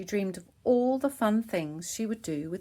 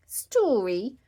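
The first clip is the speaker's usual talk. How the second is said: a hint of a little-girl voice; lightly voiced piglet question; toddler asking; 4 semitones higher, 6 dB louder than usual. A narrator's reading. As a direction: ask like an eager tiny sister. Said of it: "Peppa asked George".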